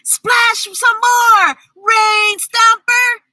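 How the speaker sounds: high, slow cheering call; children shouting. A narrator's reading. Narrator voicing the cheering children, high slow shout. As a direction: call out like excited kids at a parade. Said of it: "they shouted".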